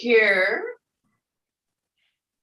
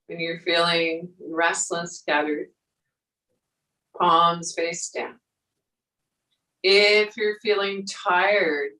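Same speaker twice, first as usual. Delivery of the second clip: guided meditation. Acted. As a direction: guide them gently through the breath. Yoga teacher guiding breath and movement.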